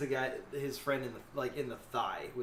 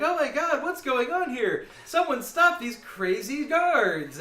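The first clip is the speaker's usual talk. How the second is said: high, loud mock panic; drawn-out, high shout for someone to intervene; alarmed yelling; 11 semitones higher, 9 dB louder, slower than usual. Game master imitates player's cry about crazy guards, alarmed yelling. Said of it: "you kinda start yelling like".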